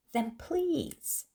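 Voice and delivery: almost crying. A narrator's reading. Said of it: "almost crying".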